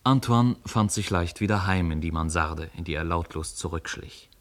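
ordinary delivery

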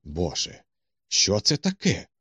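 mild startled exclamation, pitch rising; a touch of alarm, voice lifting on the question; startled cry. A narrator's reading. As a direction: read as an understated cry of surprise. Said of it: "скрикнув я".

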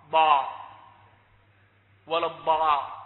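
tongue-tip ظ in place of ض in الضاء; dad as za. Shown as a demonstration. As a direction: swap the ض of الضاء for a ظ sound. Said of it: "فيجعلها عصا".

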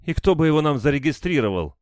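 high, loud indignant retort; scornful snort. A narrator's reading. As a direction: scoff at a silly question. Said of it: "фыркнула женщина". (фыркая, возмущённо)

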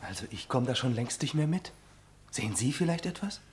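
puzzled murmur. Acted. Confused onlooker asks quietly.

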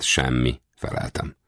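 ordinary delivery, nothing marked

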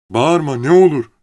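high, loud, urgent begging; pleading. A narrator's reading. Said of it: "diye yalvardı". (telaşla yalvararak)

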